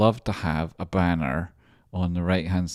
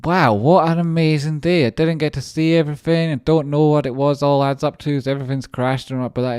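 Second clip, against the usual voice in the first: higher and louder, gushing joy; thrilled; 8 semitones higher, 7 dB louder than usual. Real, not acted. Streamer wrapping up a big day, thrilled gushing. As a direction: burst out with happy amazement.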